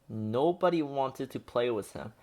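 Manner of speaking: ordinary delivery, nothing marked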